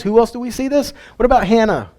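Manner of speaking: usual speaking voice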